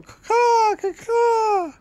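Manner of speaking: high screeching crow caw; shrill screeching bird woman. Acted. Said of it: "Maybe yell".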